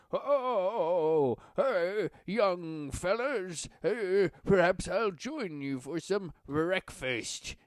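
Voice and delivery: high, slow, hammed-up old man voice; king faking a creaky old codger; exaggerated phony old man. King in bad disguise greets the party in a hammed-up old man voice. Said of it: "speaks in an overly exaggerated, phony voice".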